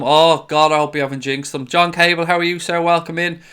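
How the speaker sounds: ordinary talk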